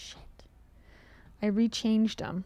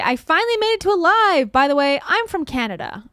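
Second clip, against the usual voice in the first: loud, high, eager reading of the comment; excited fan voice; 6 semitones higher, 8 dB louder than usual. Pretend. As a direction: read it like a thrilled fan. Reading a fan's comment aloud.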